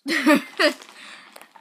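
loud burst of laughter. Real, not acted. Admitting she gets lots of pimples, loud laugh. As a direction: crack up at yourself. Laughs after self-mocking line.

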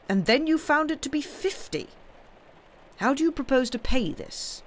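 high-pitched, pressing uncle voice; exasperated uncle. Acted. Uncle questioning Sam on his debts, exasperated. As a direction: demand an account of the money.

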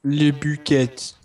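slow, hammed-up French accent; outrageous French accent. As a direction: say it like a cartoon Frenchman. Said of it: "my outrageous French person accent".

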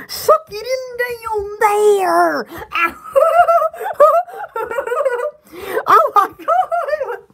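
words slowed by laughter; laughing. Real, not acted. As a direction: talk through a laughing fit. Laughing fit at camera.

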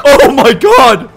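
loud, slow, high-pitched laughing exclamation; laughing disbelief. Real, not acted. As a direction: draw it out through laughter. Laughing at the terrible graphics.

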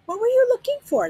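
high, loud eager question. A narrator's reading. Lena eagerly asking Sag what he sought. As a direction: ask it keenly, leaning in.

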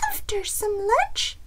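high little hen voice; hopeful chicken. A narrator's reading. Chicken asks Bear for lunch first, high hopeful squeak. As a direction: add it squeakily, hoping for food. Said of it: "Chicken said".

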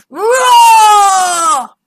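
loud high roaring shout; monster roar. A narrator's reading. Monster roars.

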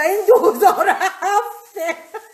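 bursting high laugh; high giggle. Real, not acted. Laughing at how strong the cloves smell, high giggle. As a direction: laugh out in delight.

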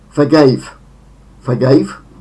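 forGAVE with a short first vowel; second-syllable stress. Shown as a demonstration. Teacher models the second form, forgave stressed on second syllable. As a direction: hit "gave" in forgave. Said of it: "akcent na drugą sylabę".

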